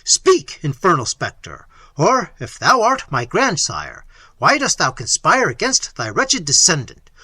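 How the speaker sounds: loud, frantic cry to the ghost; Manfred shouting at his grandfather's ghost. Acted. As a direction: demand an answer from the spectre. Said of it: "cried Manfred".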